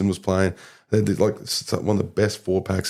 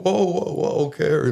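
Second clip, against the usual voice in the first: higher-pitched imitation of a startled manager; head off an angry player, pitched up; retold calming down; 9 semitones higher, slower than usual. Manager calms the upset player.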